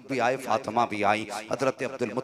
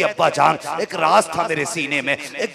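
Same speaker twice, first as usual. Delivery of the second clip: loud, higher tearful outcry; crying out; 4 semitones higher, 10 dB louder than usual. A narrator's reading. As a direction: cry it out from the pulpit. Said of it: "रो के कहती". (ऊँची आवाज़ में रोती हुई पुकार)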